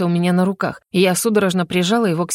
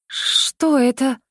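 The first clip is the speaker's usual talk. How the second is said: slow, high, dazed question; bewildered; 5 semitones higher, much slower than usual. A narrator's reading. Dazed heroine questioning her hedgehog friend after his needle jab, bewildered. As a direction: draw it out slowly in mild bewilderment. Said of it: "Недоуменно посмотрела на друга".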